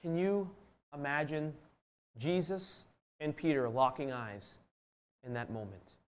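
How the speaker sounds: very soft, slow and spaced out; almost under the breath, inviting them to picture it; hushed reflection. Real, not acted.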